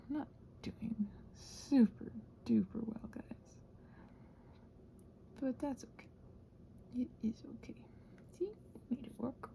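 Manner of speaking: soft whisper to the viewers; hushed, confiding whisper. Real, not acted. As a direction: quietly whisper it to yourself. Confessing low mood to viewers.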